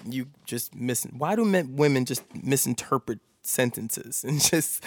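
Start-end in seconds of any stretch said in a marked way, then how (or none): none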